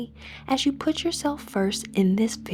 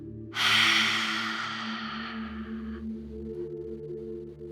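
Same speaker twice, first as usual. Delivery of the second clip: long, slowly fading breathy exhale; deep sigh out; 5 dB quieter than usual. Shown as a demonstration. Guide exhales to free oneself from mediocrity, deep sigh. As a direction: sigh all the air out in one release. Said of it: "with a deep sigh out".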